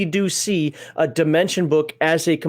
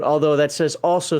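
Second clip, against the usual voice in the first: ordinary talk, nothing marked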